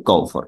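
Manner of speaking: গহ্বর said as gou-vor; gou-vor, falling at the end; correct pronunciation. Shown as a demonstration. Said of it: "এটার উচ্চারণ হবে".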